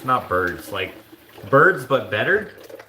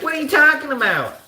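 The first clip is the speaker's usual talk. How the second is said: very high, comically distorted voice; exaggerated impression voice; 4 dB louder than usual. Moderator friend denying it, exaggerated impression voice. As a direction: overdo a mocking imitation of him. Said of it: "he doesn't sound like that".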